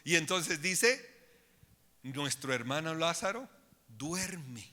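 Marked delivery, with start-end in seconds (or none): 2.0-4.7 much softer, hushed telling of the death; gently downplay the news; softened, calming. Retold or imitated. Jesus softening the news for his apostles, hushed. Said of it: "usó una figura literaria para bajarle el tono".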